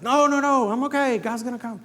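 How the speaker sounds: high, loud mock insistence; stubborn drowning man. Man in the flood waving off rescue, loud high insistence.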